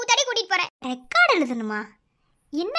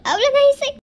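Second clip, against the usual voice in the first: louder, higher sobbing cry; crying out in anguish; 5 semitones higher, 8 dB louder than usual. Acted. Fearing she'll be taken from her husband, she cries out loud. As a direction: let it burst out through tears.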